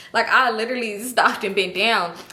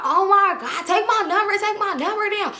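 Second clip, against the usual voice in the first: high-pitched gushing; excited reunion; 10 semitones higher than usual. Retold or imitated. Hugging her play son, acted high excitement. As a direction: act out gushing during a hug.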